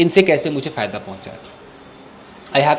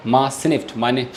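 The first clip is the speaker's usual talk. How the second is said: plain reading voice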